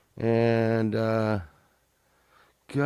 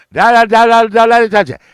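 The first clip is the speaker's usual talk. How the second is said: much higher, much louder frantic rock-star yell; 13 semitones higher, 17 dB louder than usual. Retold or imitated. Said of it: "[name] was like".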